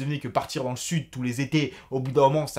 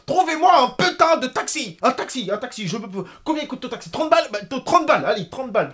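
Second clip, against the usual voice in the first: high, loud frantic demand for a taxi; retold freak-out; 8 semitones higher, 7 dB louder than usual. Stranded traveller begs for a taxi, retold frantic shouting. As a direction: re-enact losing it, pushing pitch and volume up. Je pète un câble.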